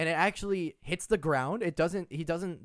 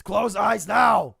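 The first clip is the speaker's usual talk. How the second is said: slow, loud orc shout; commanding orc; 5 semitones higher, 11 dB louder, much slower than usual. Acted. Orc orders his prisoner Six, slow bellowed command. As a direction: order it forcefully, word by word. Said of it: "He says".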